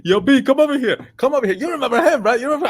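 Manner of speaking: high, eager calling over; excited summons; eager plea for backup. Retold or imitated. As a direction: urge your friend over. Friend pleading for backup.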